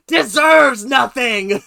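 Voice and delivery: slow, hammed-up shout; mock verdict. Echoing the coach's harsh verdict on JoJo, mock shout. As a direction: boom it out in mock outrage.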